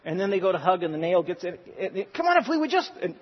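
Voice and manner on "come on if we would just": much higher, whiny plea; high pleading. Retold or imitated. Husband begging wife to let him fix it, high whine. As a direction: plead up high, half-joking.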